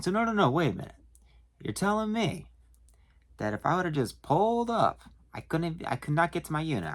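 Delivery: high-pitched mock-indignant customer; indignant disbelief. Customer arguing with the clerk about his locked unit, incredulous.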